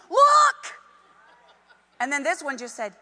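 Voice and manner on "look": "Look!" shouted high and loud; exclamation shout. Shown as a demonstration. Preacher showing the exclamation with a shout. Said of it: "with an exclamation mark".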